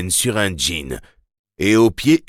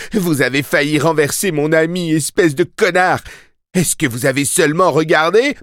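high, raised furious voice; absolute rage; 9 semitones higher, 4 dB louder than usual. A narrator's reading. Boy berating the driver who nearly hit his friend, enraged. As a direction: rail at the driver. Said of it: "Il était dans une rage absolue".

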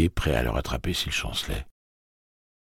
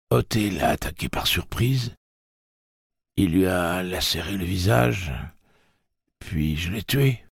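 put-on high boy voice, slowing at the end; a hurt boy telling what happened; shaken boy voice; 8 semitones higher than usual.

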